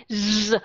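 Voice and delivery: "Z" as a long, strong buzz; overdone Z. Shown as a demonstration. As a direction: hold "Z" far too hard. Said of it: "quá mạnh đi". (âm "Z" rung dài, quá mạnh)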